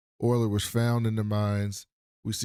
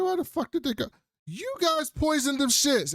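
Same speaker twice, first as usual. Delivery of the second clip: outraged cop. Retold or imitated. Cop accusing the exterminators, hammed-up high outrage. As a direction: pitch the outrage way up.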